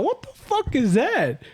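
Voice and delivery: high, slow, alarmed cry; startled. Retold or imitated. Retelling the night she heard footsteps, high startled yelp. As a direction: voice jumps up in fright. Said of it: "So I was like".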